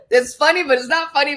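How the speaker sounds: high, loud, laughing through the words. Real, not acted. Admitting her awful story is funny, laughing. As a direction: talk through a giggle.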